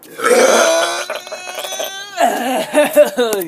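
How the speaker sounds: high wild laughter; burst of laughter. Real, not acted. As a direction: crack up loudly. Laughs at own burp.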